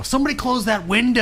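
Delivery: mock yell. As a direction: yell at the henchmen. Imagined villain shout.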